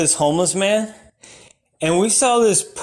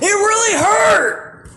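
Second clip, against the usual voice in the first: pained yelp; 13 semitones higher, much slower than usual. Acted. Comic telling a tripping story, pained squeal. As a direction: squeal that it hurt.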